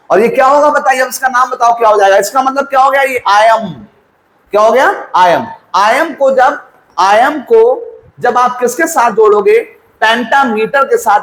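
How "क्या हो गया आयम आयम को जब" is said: normal talking voice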